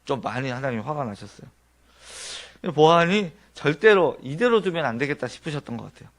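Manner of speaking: ordinary talk, nothing marked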